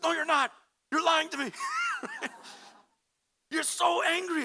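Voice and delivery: hammed-up husband insisting she's angry, laughing through it; mock accusing husband. Husband accusing silent wife.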